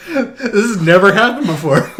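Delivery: high, loud cry-laugh; laughing fit. Real, not acted. Cohost losing it over the summary, high teary laughter. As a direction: barely get it out through laughter.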